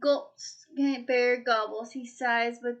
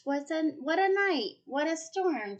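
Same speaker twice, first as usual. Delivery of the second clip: twittering wren; 5 semitones higher than usual. A narrator's reading. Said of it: "twitters wren".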